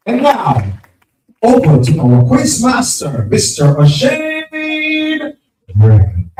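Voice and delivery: announcer voice. Real, not acted. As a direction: stretch out the name like a ringside announcer. MC introducing the quiz master.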